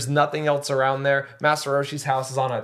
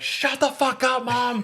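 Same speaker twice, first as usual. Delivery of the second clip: put-on high bratty kid voice; rebellious kid; 10 semitones higher, slower than usual. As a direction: snap it out like a defiant five-year-old. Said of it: "Gohan just basically was like".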